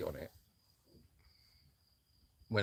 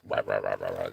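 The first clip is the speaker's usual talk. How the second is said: much lower, gruff pig rahs; pig grunting. Retold or imitated. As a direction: growl it like a greedy pig at breakfast. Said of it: "he's all like".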